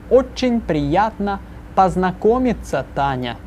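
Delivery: slow, careful model line; slow model phrase. Shown as a demonstration. Teacher models the full greeting slowly for repeating. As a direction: stretch the phrase out for students to copy. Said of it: "this painfully slowly".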